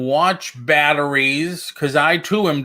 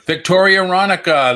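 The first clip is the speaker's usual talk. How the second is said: Victoria Ronica called out louder and higher; new nickname announced; 6 semitones higher, 5 dB louder than usual. Pretend. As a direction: proclaim Victoria Ronica like a title. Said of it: "there's a new one".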